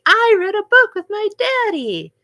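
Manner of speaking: put-on high, loud little-kid voice; six-year-old child. A six-year-old telling the teacher about daddy, high and loud. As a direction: chirp it like a small child proudly reporting. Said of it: "And they'd be like".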